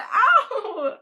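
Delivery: high, loud mock cry of pain; crazy loud ow. Hearing a shout inside your ear, mock loud yelp. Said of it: "crazy loud. You're like".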